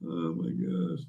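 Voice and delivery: normal talking voice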